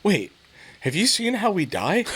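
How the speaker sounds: high, laughing sister voice; imitating his curious sister; sister's question. Sister asks about his vision, high laughing voice. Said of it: "my sister goes".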